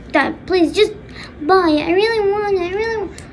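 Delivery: high, insistent begging; acted whiny daughter; pleading. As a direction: plead like a kid wanting a treat. Daughter begging her dad.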